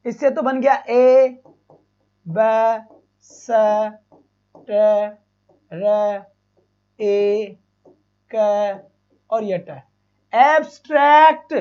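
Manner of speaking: each phoneme of एब्स्ट्रैक्ट said apart with pauses; patient teacher spelling out phonemes; sound by sound. Shown as a demonstration. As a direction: build the word slowly, one sound at a time. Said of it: "शांति से सुनो".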